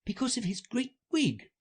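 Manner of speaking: put-on high-pitched girlish reasoning; Alice to herself. Alice explains the judge to herself, high musing voice. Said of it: "she said to herself".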